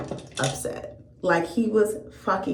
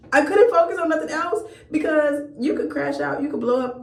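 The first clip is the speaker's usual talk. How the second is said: higher, laughing through the words; can't hold back laughter; laughing while telling; 7 semitones higher than usual. Real, not acted. Storyteller laughing at the absurd date.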